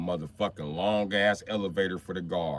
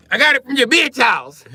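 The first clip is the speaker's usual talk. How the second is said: high, loud yelled punchline; shouted punchline; 13 dB louder than usual. Shown as a demonstration. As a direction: yell it out as the punchline. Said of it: "Hold on, let me cut on the lights".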